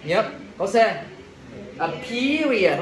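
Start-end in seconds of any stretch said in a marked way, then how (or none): 1.8-2.8 period slowly as PEER-ee-ud, higher pitched; careful model pronunciation. Shown as a demonstration. Teacher warns against a b sound, careful pronunciation. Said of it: "không bé rồi nha".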